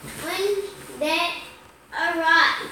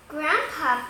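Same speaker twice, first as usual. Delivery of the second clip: ordinary delivery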